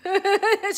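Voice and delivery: short high giggle, a little naughty; giddy giggle. Real, not acted. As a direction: laugh like you got away with something. Laughs at own naughtiness.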